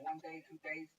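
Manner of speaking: ordinary talk, nothing marked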